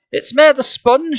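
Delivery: high, slow drawn-out announcement; announcing. A narrator's reading. Lauren revealing the fake rock, announcing. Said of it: "she announced".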